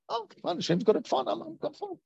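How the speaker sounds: high, soft sing-song mockery; mock complacent. To students, mocking false calm, high soft sing-song. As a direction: wave it off like a lazy truster.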